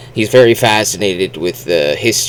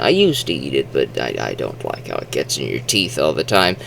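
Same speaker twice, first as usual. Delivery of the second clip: ordinary talk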